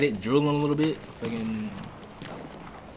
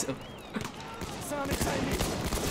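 high giggle; 9 dB quieter than usual. Real, not acted. Laugh after kill.

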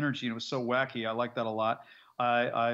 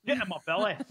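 higher, playful Scottish impression; Scottish accent; 6 semitones higher than usual. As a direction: growl it out like a Scot. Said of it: "a Scottish accent every now and then".

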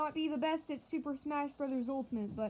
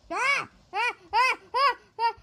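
short high loud yelps; repeated frantic shrieks; panicked screams; 11 semitones higher, 14 dB louder than usual. Real, not acted. Barrage of rockets.

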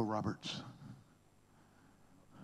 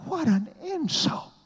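much higher and louder, rising in disbelief; outraged exclamation; 6 dB louder than usual. Shown as a demonstration. Preacher repeating the insult to the crowd, shocked and high.

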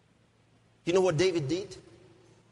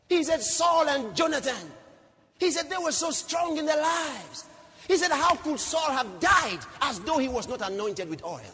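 high-pitched preaching cry; preacher dramatizing a king's grief; impassioned lament; 10 semitones higher than usual. Acted. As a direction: wail it out as a preached protest.

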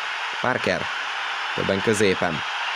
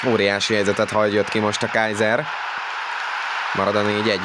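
normal talking voice

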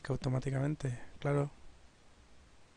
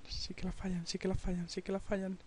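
muttering to himself; 4 semitones higher, 9 dB quieter than usual. Real, not acted.

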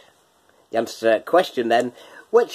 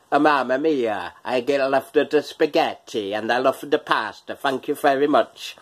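hammed-up Italian accent, slightly wrong; mock Italian accent; 2 semitones higher than usual. Quiz host teasing his Italian watchers, mock Italian accent. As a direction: put on a playful, botched Italian accent. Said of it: "that little bit of an accent".

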